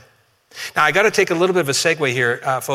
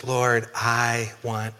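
soft, slow, pleading request; a blind man asking quietly for his sight; hushed slow plea that fades away; humble plea; 5 semitones lower, 7 dB quieter, much slower than usual. Retold or imitated. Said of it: "He says".

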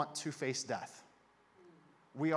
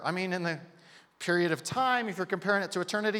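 ordinary talk, nothing marked

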